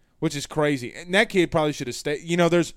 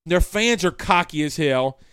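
ordinary talk, nothing marked

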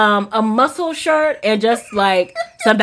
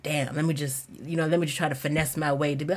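retold muttered thought; 9 semitones lower, 13 dB quieter than usual. Muttered thought while parking.

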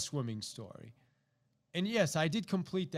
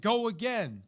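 loud command; 6 semitones higher, 6 dB louder than usual. Retold or imitated. Coach orders swimmer to continue.

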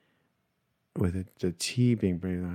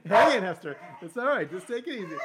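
soothing the dog; 11 semitones higher than usual. Real, not acted.

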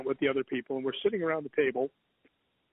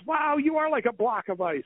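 high, sustained mock astonishment; retold amazement; 9 semitones higher than usual. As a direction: imitate a girl amazed at the touch. Retells second girl's amazed reaction.

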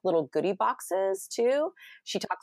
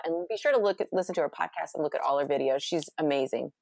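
normal talking voice

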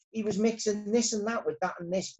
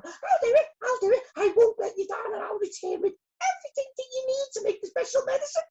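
very high, eager little bee voice; keen, high-pitched storybook helper; very proud; 3 dB louder than usual. A narrator's reading. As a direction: promise proudly in a squeaky voice. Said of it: "she said very proudly".

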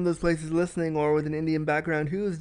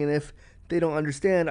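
ordinary delivery

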